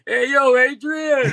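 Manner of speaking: high, loud Philly street shout; Rocky impression. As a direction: yell for Adrian like Rocky. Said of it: "people are gonna think they're listening to a Rocky movie".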